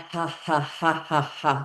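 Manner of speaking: measured, evenly spaced ha-has; deliberate, spoken-out fake laugh; demonstrated ha-ha sounds. Shown as a demonstration. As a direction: count out laughing sounds on the out breath. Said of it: "See how many ha, ha sounds".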